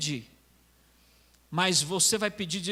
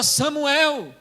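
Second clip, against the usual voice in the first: lightly voiced divine summons, raised; a raised call to someone asleep; God calling; 4 semitones higher, 4 dB louder than usual. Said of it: "E Deus falava".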